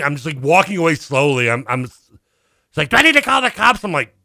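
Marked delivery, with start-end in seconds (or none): 2.9-3.8 put-on high, accusing old woman; a touch of the suspicious neighbour lady; accusing older lady. Older neighbour accusing her of stealing, high and suspicious. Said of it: "she's older lady".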